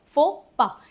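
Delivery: फोपा in the French way, "fo-pa"; French pronunciation. Shown as a demonstration. Teacher models French फोपा, fo-pa. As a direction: say फोपा as fo-pa, not faux pas. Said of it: "French तरीके से ही बोला जाता है".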